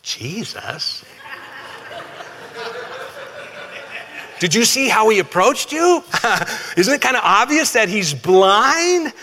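playing the baffled disciples, laughing at how obvious it is; incredulous disciples. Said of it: "I'm trying to imagine the disciples. They're going".

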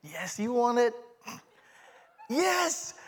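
high, playful sales coaxing; imitated cutesy pitch to a customer; coaxing potato seller. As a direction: coax them in a small high voice. Acting his potato sales pitch.

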